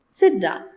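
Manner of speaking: "Sit down" dropping steeply to a low "down"; firm order with a falling "down"; falling-tone command. Shown as a demonstration. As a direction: give an order, letting "down" fall low. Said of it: "make use of falling tone".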